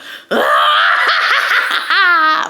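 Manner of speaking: loud, high imitated burst of laughter; big hammed-up cackle; cracking up. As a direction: burst into shrieking laughter, imitating drunk friends. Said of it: "they start cracking up".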